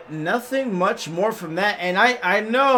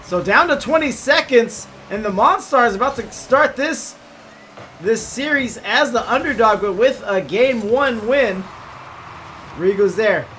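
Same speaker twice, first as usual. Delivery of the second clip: play-by-play; 3 semitones higher, 4 dB louder than usual. Acted. Live play-by-play casting.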